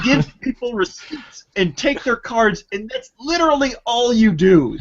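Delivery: high, scolding indignation; indignant. Real, not acted. Customer scolding a gas station clerk who won't stop joking, indignant and high.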